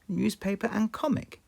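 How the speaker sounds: put-on high little girl voice; child handing over shopping; Peppa voice. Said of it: "Peppa said".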